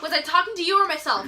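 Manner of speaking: high, loud comeback at someone; sassy retort. Retold or imitated. Acted sassy retort to the boy.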